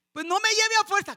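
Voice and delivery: high, loud protest; protesting daughter. Retold or imitated. Daughter loudly protesting being taken by force. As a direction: push back at mom.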